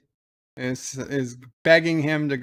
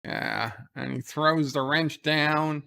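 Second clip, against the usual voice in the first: usual speaking voice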